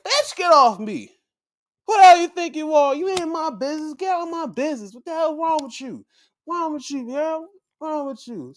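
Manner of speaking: high, fast mock-furious rant; fly off the handle, loud then rapid-fire; crazed outburst. Wrestler turning on her celebrating partner, crazed and high-pitched. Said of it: "She was going crazy".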